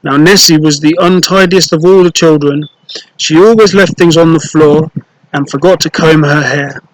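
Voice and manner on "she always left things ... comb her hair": usual speaking voice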